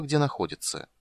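usual speaking voice

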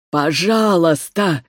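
slow drawn-out whimper; begging for his life, voice cracking; whimpering plea. Acted. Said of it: "хныкал рождённый Грацией".

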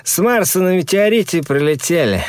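high tipsy lad's voice; drunk young man. Acted. Drunk friend voiced in story.

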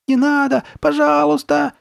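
very high, loud, desperate begging; high-pitched panicked squeal; squealing in terror. A narrator's reading. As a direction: shriek for mercy like a little boy. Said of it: "верещал Ноа".

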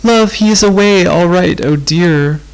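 a lost, tearful wail. A narrator's reading. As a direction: wail it out into the dark. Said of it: "wailed Rose".

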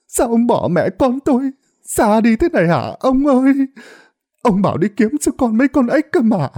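high, keening wail for the dead husband; wailing lament. Acted. Wife wailing over her drowned husband's corpse, high keening lament. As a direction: cry out over his body, grief breaking loose. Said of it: "liền òa khóc nức nở".

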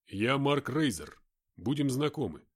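a hint of a gruff fighter; lightly voiced tough soldier. Mark introduces himself.

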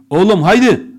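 loud, high Oğlum, haydi!; father's shout. Retold or imitated. Father yelling to wake his son, loud. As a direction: roar the wake-up call. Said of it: "Baba daha odaya bile girmeden".

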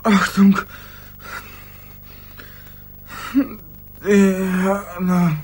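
tief, langsam, wie aus weiter Ferne; verfremdete tiefe Traumstimme. Acted. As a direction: ein dumpfer Albtraum. (tiefe, verzerrte Traumstimme aus der Ferne)

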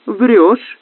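high, crisp accusation; clearly articulated put-down; crisp woman's voice. A narrator's reading. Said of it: "произнёс чёткий женский голос".

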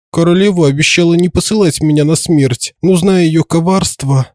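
high, shaky frightened reply; trembling voice. A narrator's reading. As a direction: speak up high like a scared man. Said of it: "дрожащим голосом начал отвечать колдун".

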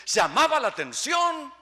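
high, loud punch; preaching shout. Real, not acted. Preacher stressing the early church, loud shout. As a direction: land the point with a shout.

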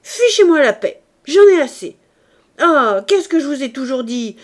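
loud, high furious outburst; exploding in anger. Acted. Furious nephew yelling back at the weeping uncle, loud. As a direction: blow up, yelling and fast. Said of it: "hors de lui, éclata".

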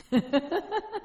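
short high-pitched chuckle; laugh. Real, not acted. Teacher amused by the locker story, laughing. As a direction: break into a brief rising laugh.

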